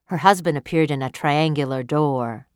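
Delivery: ordinary delivery, nothing marked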